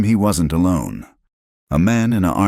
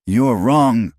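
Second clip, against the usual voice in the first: higher and louder, a desperate protest; desperately; 7 semitones higher, 4 dB louder than usual. Acted. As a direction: blurt it out in desperation. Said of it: "Clara said desperately".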